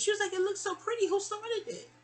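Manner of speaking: very high-pitched imitated girlfriend; girlfriend's voice. Girlfriend finishing her hair, high imitated voice. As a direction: put on a high, girly coo.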